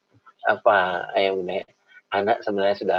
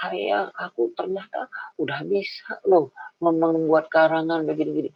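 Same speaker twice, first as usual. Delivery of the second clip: put-on proud child; 5 semitones higher, slower than usual. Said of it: "anak saya bilang".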